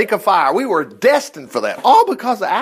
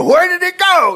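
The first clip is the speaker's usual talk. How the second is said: mock frantic search; 13 semitones higher, 4 dB louder than usual. Acting out a lost wrench.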